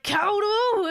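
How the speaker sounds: loud startled call of a name, high. Retold or imitated. Haruhi catching the ghost, loud shouted call. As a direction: shout his name as she catches him.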